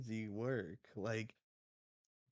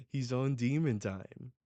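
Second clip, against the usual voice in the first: ordinary delivery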